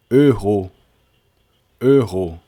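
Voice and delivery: Errou said loud as French e-ro, no h; French héros. Shown as a demonstration. Teacher correcting students, French pronunciation. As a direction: Errou clearly pronounced e-ro, the French way. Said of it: "Não é erro, não".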